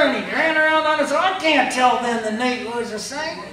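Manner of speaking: high, loud, outraged refusal; indignant preacher. Retold or imitated. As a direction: protest loudly, scandalized. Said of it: "he said".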